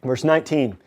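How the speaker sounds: ordinary talk, nothing marked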